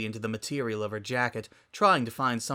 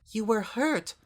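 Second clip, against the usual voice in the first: higher, trembling hurt; wavering voice; 11 semitones higher, slower than usual. A narrator's reading. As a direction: let the voice shake on hurt. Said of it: "voice wavering".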